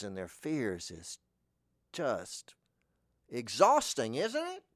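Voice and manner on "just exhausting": ordinary delivery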